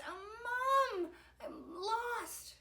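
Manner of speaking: high, slow, drawn-out cry; plaintive wail. A narrator's reading. Victor lost in the castle to Mom, plaintive wail. Lost boy calls mother.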